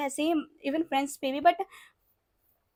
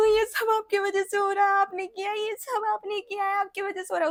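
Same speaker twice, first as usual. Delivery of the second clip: mock crying accusation; 4 semitones higher than usual. Drunk girl sobbing blame at her ex on the phone. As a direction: whine it through fake tears at him. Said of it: "call लगा के मैं रोने लगी सीधा".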